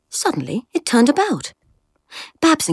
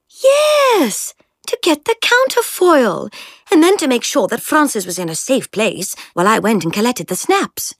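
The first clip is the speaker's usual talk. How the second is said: put-on teasing upper-class girl; 7 semitones higher than usual. A narrator's reading. Jemima's voice.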